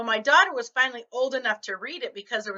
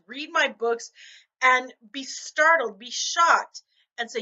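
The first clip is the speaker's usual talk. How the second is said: ordinary delivery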